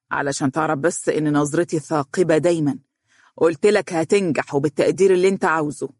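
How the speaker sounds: ordinary delivery, nothing marked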